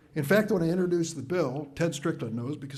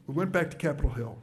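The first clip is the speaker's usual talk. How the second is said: ordinary talk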